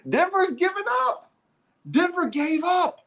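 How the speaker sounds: high, laughing call; laughing disbelief. Real, not acted. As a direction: say it through a laugh.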